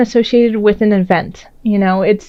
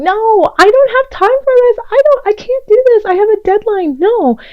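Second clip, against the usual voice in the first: grumbling protest; 11 semitones higher than usual. Pretend. Virgo grumbling at partner dragging them to dinner, high whiny protest. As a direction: squeak out busy excuses like a stressed workaholic. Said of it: "you know, grumbling".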